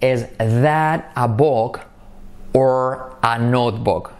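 very slow, spaced words; slow it right down for repeating; slow question demo; slow repeat model. Shown as a demonstration. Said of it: "Repite conmigo".

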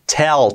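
"Tell" with the final l held and clear; marked L. Shown as a demonstration. Teacher showing the key letter, marked l in "Tell". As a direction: lean on the l ending "Tell". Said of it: "Fijaos cómo marco la L".

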